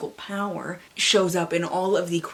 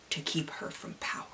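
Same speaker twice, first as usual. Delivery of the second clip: ominous whisper; 2 semitones lower, 11 dB quieter than usual. Acted. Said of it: "dramatic readings".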